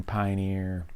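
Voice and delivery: ordinary talk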